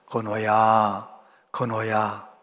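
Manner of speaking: low, slow, soft call of a name; a distant voice calling gently, lightly done; calling voice from behind. Retold or imitated. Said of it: "음성이 들렸어요".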